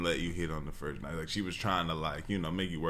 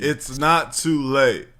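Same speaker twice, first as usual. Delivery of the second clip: mock urging; 5 semitones higher, 9 dB louder, slower than usual. Co-host jokingly urges him on.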